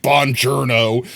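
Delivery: slow, drawn-out mangled Buongiorno; bad Italian accent. Retelling the movie scene, a hammy fake-Italian Buongiorno. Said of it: "he goes, like".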